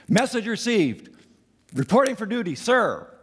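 high, loud, brisk mock salute; eager soldier. Pretend Moses reporting for duty, loud mock soldier. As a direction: snap to attention like a recruit.

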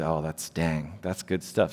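soft low mock murmur; murmured approval. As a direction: murmur it like a pleased listener. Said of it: "I say".

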